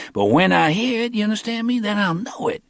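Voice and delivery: lightly voiced young hustler; Cornell's voice.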